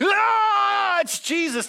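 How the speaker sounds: frantic warning yell; alarmed yell. Retold or imitated. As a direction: shout to stop a speeding driver. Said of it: "You're gonna be like".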